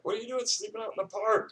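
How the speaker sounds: high mocking officer voice; quoting angry officers. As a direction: squeak it like a scolding sergeant. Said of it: "they, they were like".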